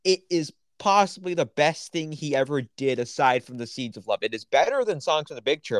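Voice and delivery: excited, certain verdict. Real, not acted. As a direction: gush with total conviction. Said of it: "I don't think I've ever heard you sound so excited".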